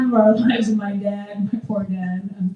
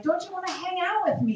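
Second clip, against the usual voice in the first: pleading mom; 8 semitones higher, 6 dB quieter than usual. Retold or imitated. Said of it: "you're like".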